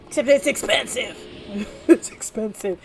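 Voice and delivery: usual speaking voice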